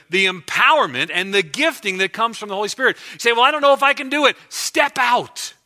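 loud, high preaching build; preaching at full force. Acted. Pastor exhorting his congregation, preaching at full force. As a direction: drive it home to the congregation, full voice.

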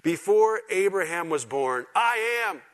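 slow, loud, high-pitched declaration; emphatic proclamation. Real, not acted. Preacher quoting Jesus's statement of divinity, ringing proclamation. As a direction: proclaim it, rising to a ringing finish.

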